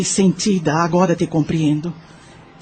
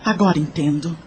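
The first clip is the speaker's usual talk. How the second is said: ordinary delivery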